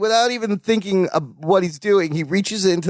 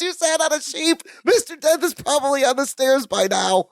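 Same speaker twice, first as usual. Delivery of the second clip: retold panicked scream; 7 semitones higher than usual. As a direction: imitate a panicked shriek at ordinary volume. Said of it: "instantly turns and looks and screams".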